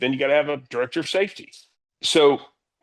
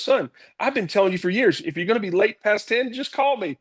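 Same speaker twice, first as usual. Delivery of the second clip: exasperated dad, pitched high; high, insistent mock reprimand; retold parental scolding; 9 semitones higher than usual. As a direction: imitate a father lecturing his son. Retold dad scolding his son.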